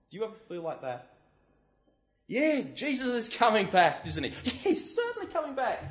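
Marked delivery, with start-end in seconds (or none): 2.3-5.8 mock laughing. Laughing at complacent believers.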